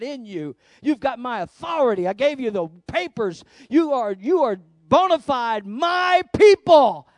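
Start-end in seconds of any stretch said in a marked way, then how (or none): 3.7-7.1 slow, much louder and higher shout; preaching shout. Real, not acted. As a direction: thunder it out to the congregation. Preacher declares God's claim.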